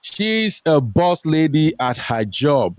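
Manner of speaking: normal talking voice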